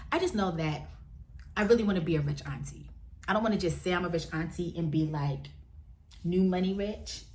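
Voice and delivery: ordinary delivery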